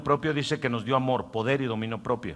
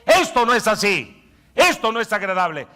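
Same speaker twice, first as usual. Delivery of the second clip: higher, louder, slow stern commands; commanding shout; 10 semitones higher, 9 dB louder, slower than usual. Acted. Fasting silencing the inner voice, stern shouted commands. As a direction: shout down the craving voice. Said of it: "Le dice".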